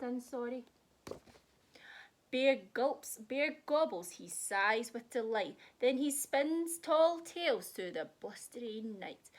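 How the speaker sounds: lively, sing-song picture book read; reading to children. A narrator's reading. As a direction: read it like a bedtime story for kids. Reads picture book aloud.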